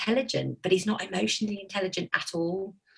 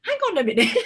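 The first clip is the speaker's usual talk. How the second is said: high, amused hang on, laughing; mock protest through a laugh; laughing disbelief; 8 semitones higher, 4 dB louder, faster than usual. Mother recalls son crying at Dobby, amused laughing protest.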